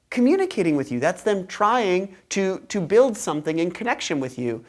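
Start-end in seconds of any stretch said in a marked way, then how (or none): none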